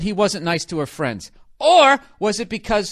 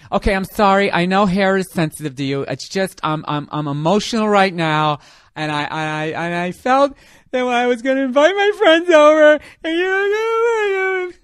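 higher, louder tearful voice; crying girlfriend; 4 semitones higher, 5 dB louder than usual. Acted. Girlfriend turning to tears in the argument, higher and louder. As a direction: break into mock tears as she argues. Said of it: "Why did that fictitious bitch start crying".

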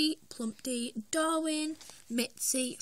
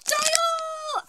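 very high, loud cry of joy; thrilled shout; 5 dB louder than usual. Real, not acted. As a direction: shout the name as a triumphant reveal. Shouting the revealed figure's name.

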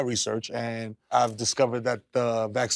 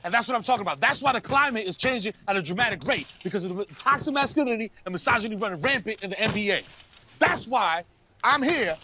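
much higher, preachy and self-important; grandiose rant; 10 semitones higher than usual. Acted. Kyrie ranting to the reporters about masculinity, high preachy rant. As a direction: proclaim it like a prophet.

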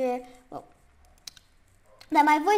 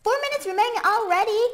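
rushed, higher alarmed cry; panicked, fast and high; 10 semitones higher, 8 dB louder, faster than usual. Real, not acted. Timer panic.

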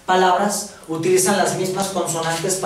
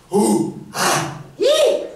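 grunted uh and ah, then a sudden squeaky high i, i; monkey vowel sounds; 14 semitones higher, much slower than usual. Shown as a demonstration. Teacher imitates monkeys making vowels for the class, grunts then high squeaks. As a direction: sound like a chimp trying to talk. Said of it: "Los changos pueden decir vocales".

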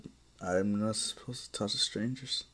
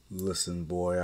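ordinary delivery, nothing marked

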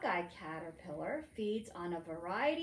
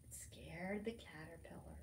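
scared hushed whisper; breathless story whisper; 3 semitones lower, 9 dB quieter than usual. Acted. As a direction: whisper it like a secret fright. Whispered after gasp.

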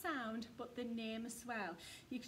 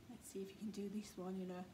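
whisper; 4 semitones lower, 5 dB quieter, faster than usual. Shown as a demonstration. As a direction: still whispering, quick. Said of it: "the quiet one".

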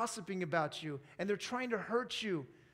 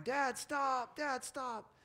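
put-on higher-pitched kid calling for Dad; son crying out; 7 semitones higher, much slower than usual. Father imitating his son on the bike ride, crying out. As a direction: imitate a boy's distressed yell. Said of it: "one of my sons crying".

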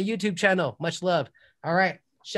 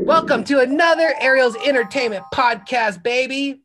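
louder and higher host welcome; podcast host re-opening with a grin; hype show host intro; 6 semitones higher, 7 dB louder than usual. Acted. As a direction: sell the show with energy. Hyped show intro again.

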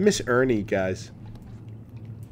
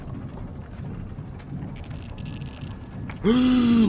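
gasp; 7 semitones higher than usual. Real, not acted. Horror game scare.

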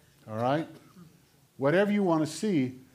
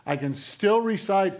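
loud, insistent sermon voice; forceful preaching; 3 semitones higher, 5 dB louder than usual. Acted. Preacher warning of becoming hard-hearted, forceful preaching. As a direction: press the conviction on the congregation.

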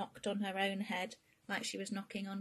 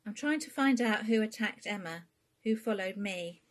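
Bea confessing; 4 semitones higher, 9 dB louder than usual. Acted. Bea confesses to her mum.

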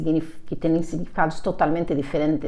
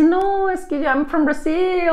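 high-pitched self-imitation, making excuses; pleading excuse; 10 semitones higher than usual. Learner explaining herself to laughing classmates, high pleading excuse. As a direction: plead your case in a higher voice. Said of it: "y así".

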